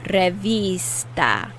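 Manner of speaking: "Revista" said slowly, its I stretched and stressed. Shown as a demonstration. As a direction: draw out the I, pronounced "ee", in "Revista". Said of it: "Notice I'm putting more emphasis on the I".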